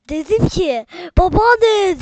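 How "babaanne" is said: loud, high holler out the window; shouting for grandma. Retold or imitated. Gamer reenacts calling his grandmother from the window, shouting. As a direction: yell it like calling someone from afar. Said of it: "diye bağırdım şu camdan".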